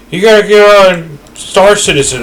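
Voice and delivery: hammed-up impression of an insistent fan; mocking pushy fans. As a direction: parrot the nagging fans with a sneer. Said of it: "Everyone's always like".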